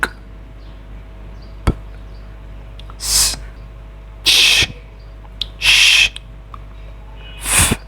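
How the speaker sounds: k, p, s, ch, sh, f as bare consonants without voice; each of k, p, s, ch, sh, f a lone puff or hiss of air; isolated voiceless sounds. Shown as a demonstration. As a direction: sound out k, p, s, ch, sh, f one by one, pausing between. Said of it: "verbs ending in voiceless sounds".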